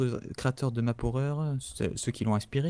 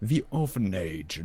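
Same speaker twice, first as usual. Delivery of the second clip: English accent; 5 semitones lower, slower than usual. Real, not acted. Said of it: "accent anglais, euh, de la mort".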